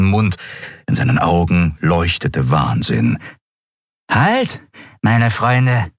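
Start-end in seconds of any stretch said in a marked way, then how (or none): 4.1-5.9 high, youthful wretch calling a halt; grotesquely young voice. A narrator's reading. Emaciated stranger stops two travellers, grotesquely young and high. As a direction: hail them in a freakishly young, high voice. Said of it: "besaß eine grotesk junge Stimme".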